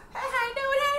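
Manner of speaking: high-pitched imitated American accent; hammed-up American TV greeting; imitating American TV actors. Shown as a demonstration. Mimicking actors from American shows, exaggerated American accent. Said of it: "gagayahin ko yung mga palabas doon".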